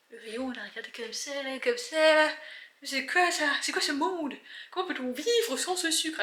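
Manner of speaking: high, theatrical dismay; mock outrage. Shopper reliving the empty sugar shelf, mock outrage.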